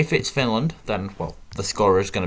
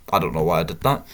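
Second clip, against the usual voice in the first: ordinary talk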